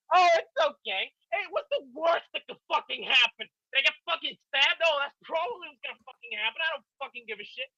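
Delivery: high-pitched sarcastic inner voice; mock fearless bravado. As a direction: ham up your reckless calm. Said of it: "looking at him like".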